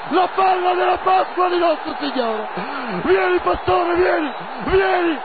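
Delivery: ecstatic screaming, high and slow; breathless italian goal commentary. Real, not acted. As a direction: scream the last-minute goal. Last-minute equalizer celebration.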